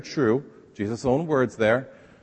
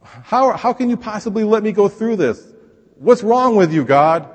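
much higher, loud accusing whine; complaining to God; 10 semitones higher, 8 dB louder, slower than usual. Pretend. Pastor acting a believer yelling accusations at God. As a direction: cry out at God, indignant and hurt. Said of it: "we kind of say".

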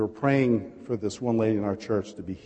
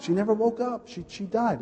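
mock crying wail; 12 semitones higher than usual. Wife explaining her mother died, imitated wailing. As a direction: imitate a grieving daughter, pitched up. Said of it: "she started crying and screaming".

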